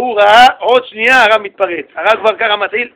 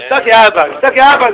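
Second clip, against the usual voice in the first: loud teasing "shut up, dad"; a loud joking jab at a buddy; mock insult; 3 semitones higher, 7 dB louder than usual. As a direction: bark it out playfully like friends' slang. Acted slang insult to father.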